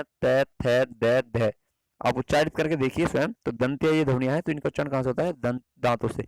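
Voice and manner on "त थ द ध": "त, थ, द, ध" each sounded separately; tongue on upper teeth for "त थ द ध"; dental sounds demo. Shown as a demonstration. Teacher to students, sounding out "त, थ, द, ध" one by one. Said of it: "आप बोलकर देख सकते हैं".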